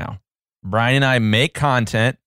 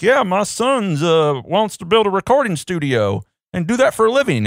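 put-on dumb Southern accent; 7 semitones higher than usual. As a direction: play a simple Southern dad bragging about his son. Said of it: "make them sound so dumb with the Southern accent".

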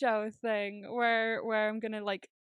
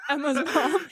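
loud, higher words through laughter; real laughing; 6 semitones higher, 10 dB louder than usual. Real, not acted. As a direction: crack up while listing relatives. Cracking up at cousin's story.

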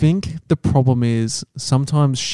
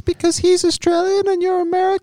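put-on piping falsetto girlfriend; high-pitched girlfriend. Playing own girlfriend complaining about the boyfriend, high falsetto. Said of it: "My girlfriend's got quite a high-pitched voice".

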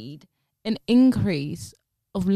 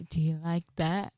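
soft sultry; 5 semitones lower, 9 dB quieter than usual. Pretend. Mock seductive roleplay line.

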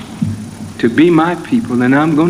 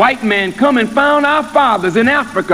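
higher, driving preacher's cadence that settles at the end; preaching; 8 semitones higher than usual. Acted. Minister preaching on patience to the congregation, rising then slowing.

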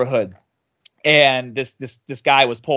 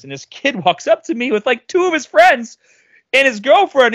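usual speaking voice

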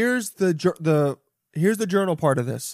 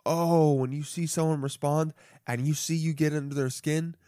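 ordinary talk

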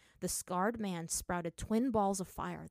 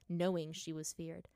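ordinary delivery, nothing marked

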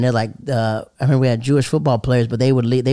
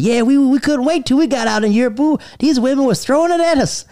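put-on high, excited old-timer voice; playing the eager old soldier; grandfather's friend; 12 semitones higher than usual. Grandfather's war buddy reminiscing, high excited voice. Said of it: "He's like".

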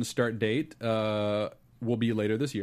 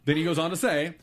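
ordinary talk, nothing marked

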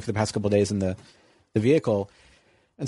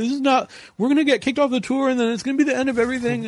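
panicked plea; 12 semitones higher than usual. Retold or imitated. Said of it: "trying to be".

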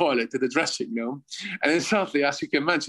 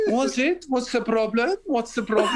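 lightly imitated breezy French flair, higher pitch; flamboyant French player; 8 semitones higher than usual. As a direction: play it carefree and innocent, pitched up. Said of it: "a very French flamboyant".

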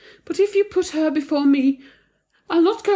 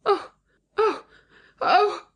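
three separate pained "oh"s, rising high; high anguished "oh", repeated with pauses; wounded cries; 13 semitones higher, much slower than usual. A narrator's reading. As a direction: cry out as if struck. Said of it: "cried Thyra".

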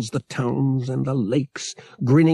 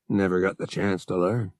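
lower, mildly sheepish admission; a little embarrassed; 5 semitones lower than usual. A narrator's reading. Man admitting he cannot swim, a little embarrassed. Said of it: "a little embarrassed".

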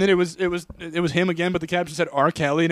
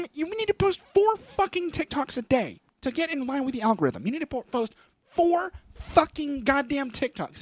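much higher, pushy hustle advice; Gary Vee impression; 11 semitones higher, 4 dB quieter than usual. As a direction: preach the grind pitched high. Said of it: "amazing Gary Vee".

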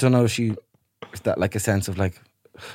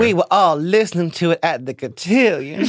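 Southern belle; 4 semitones higher than usual. Pretend. Cohost improvising as a Southern belle. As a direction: play a genteel Southern belle at the cotillion.

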